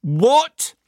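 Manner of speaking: high, loud, outraged "What?"; indignant shout. Retold or imitated. Listener yelling at the podcast in outrage, high and loud. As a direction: yelp it back at the radio in disbelief. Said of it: "I should have shouted, but it was more of a gargled croak".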